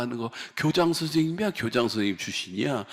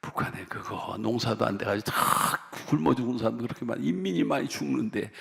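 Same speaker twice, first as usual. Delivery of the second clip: ordinary talk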